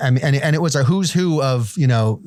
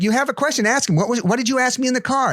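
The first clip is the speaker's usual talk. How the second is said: insistent aunt; 11 semitones higher than usual. Retold or imitated. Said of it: "she's like".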